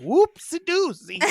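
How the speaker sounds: high, slow sing-song whoopsie; cutesy voice. Retold or imitated. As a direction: coo it like a cutesy toddler. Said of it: "a date and went".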